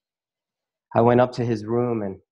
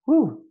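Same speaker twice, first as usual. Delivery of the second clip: high whew to hold back tears; emotional exhale; 14 semitones higher, 6 dB louder than usual. Real, not acted. Speaker about to cry before his audience, steadying exhale. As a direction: blow out a real, steadying sigh. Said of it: "if I'm gonna cry here".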